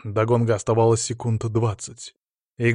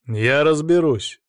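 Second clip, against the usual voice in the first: brash, drawn-out retort, a little higher; insolent reply; 4 semitones higher, 4 dB louder, slower than usual. A narrator's reading. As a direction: answer back with slow insolence. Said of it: "дерзко ответил рефери". (дерзкий, тягучий ответ)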